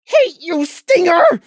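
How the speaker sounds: high, bitter shout; shouting again. A narrator's reading. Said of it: "he shouted again".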